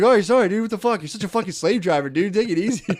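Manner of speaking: mocking protest. Mocking the boss back.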